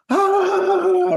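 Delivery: high mock shriek of panic; overwhelmed person yelping at a messy room; panicked yelp. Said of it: "you're like".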